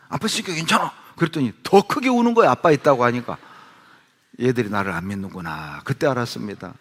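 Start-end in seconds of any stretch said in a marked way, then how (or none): 0.1-0.9 put-on confident dad talking over crying kids; firm reassurance. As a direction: insist the child is safe, with 있으니까 pushed up. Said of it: "제가 우리 딸들에게".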